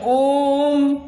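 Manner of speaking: ओम held long and slow; drawn-out ओम. Shown as a demonstration. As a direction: stretch ओम to triple length. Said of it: "तिगुना समय लगता है". (ओम को लंबा खींचकर)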